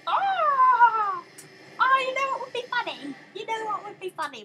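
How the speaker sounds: very high, loud wail; high-pitched exasperation. Real, not acted. Player after another failure, high exasperated wail. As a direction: squeal it out in exasperation.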